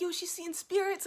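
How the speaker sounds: high-pitched retold astonishment; acted-out wonder; amazed reaction. Retold amazement at niece seeing spirits.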